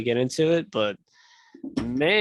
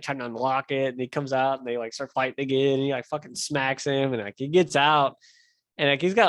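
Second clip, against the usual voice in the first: ordinary delivery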